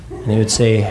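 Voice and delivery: ordinary talk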